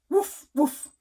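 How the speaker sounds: loud, high woof woof; dog barking. Acted. Parent to child, a loud playful dog bark. Parent reading animal sounds.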